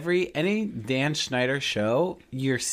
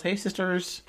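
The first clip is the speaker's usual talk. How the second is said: James Charles greeting; 8 semitones higher, slower than usual. Retold or imitated. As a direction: belt out the YouTuber catchphrase. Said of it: "James Charles".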